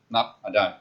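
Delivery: ordinary talk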